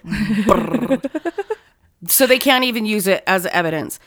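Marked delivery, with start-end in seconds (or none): 0.0-1.0 imitated springy doorstop rattle; vibrating doorstop noise; door stopper boing. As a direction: flutter out a "brrr" like a sprung door stopper. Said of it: "Door stopper".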